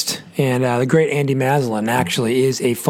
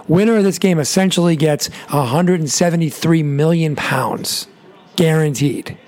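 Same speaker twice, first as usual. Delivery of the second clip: ordinary delivery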